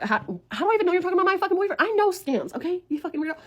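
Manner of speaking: high, rapid retort to a scammer; indignant challenge. Retold or imitated. Challenging a stranger who claims to have a video, indignant. Retold quarrel with a suspected scammer.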